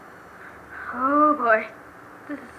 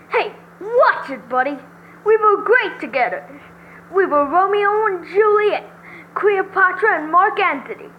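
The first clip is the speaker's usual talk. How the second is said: high, loud, offended protest; indignant defendant; 6 semitones higher, 6 dB louder than usual. Acted. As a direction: protest loudly as the jilted boyfriend.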